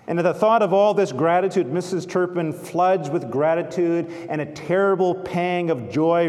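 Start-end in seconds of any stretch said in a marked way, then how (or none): none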